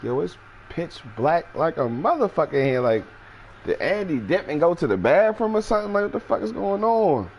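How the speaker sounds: high, baffled voice; confused. Retold or imitated. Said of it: "I'm like".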